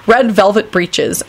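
ordinary delivery

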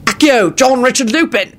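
high, carrying call; shouted spell. A narrator's reading. Lupin summons the remains by wand, shouting out. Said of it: "he shouted out".

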